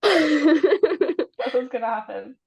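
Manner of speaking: slow words broken by laughter; laughing. Real, not acted. Laughing at her own joke.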